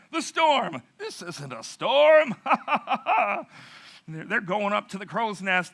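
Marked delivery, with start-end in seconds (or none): 0.1-2.3 loud, high hollering over a storm; storm-defying mock bellow; acted shouting. 2.4-4.0 loud, high acted guffaw; hammed-up derisive sailor laughter; mocking sailor laugh. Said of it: "they're laughing at this little".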